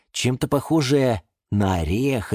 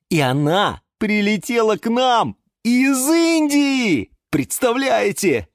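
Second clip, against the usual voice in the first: high, slow, emphatic wonder; excited announcement; 14 semitones higher, 4 dB louder, slower than usual. Acted. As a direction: share astonishing news with friends. Yura announces parcel from India. (восторженно)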